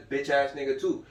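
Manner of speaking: usual speaking voice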